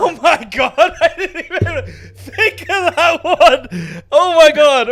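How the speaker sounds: high, loud laughing through the words. Real, not acted. Host laughing hard at his co-host's joke, high and loud. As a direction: crack up while trying to talk.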